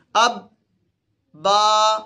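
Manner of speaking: "اب" stopped short, then a long level "با"; teacher's model "اب", pause, loud steady "با"; correct pronunciation demo. Shown as a demonstration. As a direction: close on the b of "اب", release into held "با". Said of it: "یہ اس کی pronunciation ہے".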